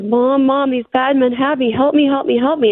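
mild imitation of a terrified plea; pleading for help. Mother retelling her daughter's cry for help, pleading. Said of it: "pleading and sobbing and crying for help".

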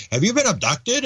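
mock quizzical researcher; a hint of Strassman questioning a subject; imitating researcher. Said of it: "And he's going".

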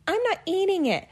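high-pitched mock kid whine; whiny complaining kids. Mom imitating her kids complaining about gross food, high whine. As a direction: imitate children griping about dinner. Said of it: "They all start complaining".

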